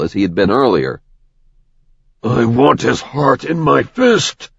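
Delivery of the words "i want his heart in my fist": slow, menacing snarl. A narrator's reading. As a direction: spit it out with rage. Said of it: "Zeth snarled".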